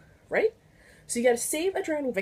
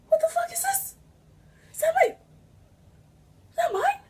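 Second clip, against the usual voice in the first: incredulous shock. Retold or imitated. Shocked at assigned victim.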